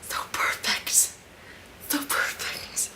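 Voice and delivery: soft, breathless whispering; awed whisper. Real, not acted. Fan overcome after the scene, whispering. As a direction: whisper it, spent with adoration.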